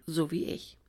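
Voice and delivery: plain reading voice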